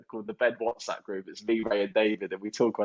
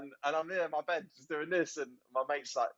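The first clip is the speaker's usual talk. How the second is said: ordinary talk, nothing marked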